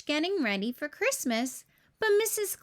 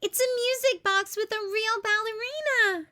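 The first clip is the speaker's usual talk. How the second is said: a much higher, bubbly, thrilled voice; excited Baby Bop; 9 semitones higher than usual. A narrator's reading. Baby Bop shows off the music box, excited. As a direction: gush over the new toy. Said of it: "giggled Baby Bop".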